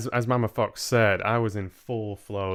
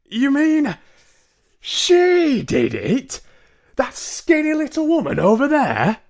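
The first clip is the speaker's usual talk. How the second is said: disbelieving shout; 10 dB louder than usual. Acted. Mister Jenkins doubting the grandmother, incredulous high shout.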